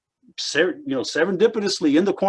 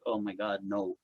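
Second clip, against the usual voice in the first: dismayed groan; 8 semitones lower, 12 dB quieter than usual. Retold or imitated. Dismay at one moment.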